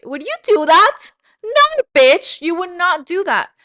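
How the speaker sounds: loud, high-pitched burst of mock outrage; outraged scolding. Speaker scolding the listener over ignoring a child, loud outrage. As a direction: yell it like an appalled friend setting them straight.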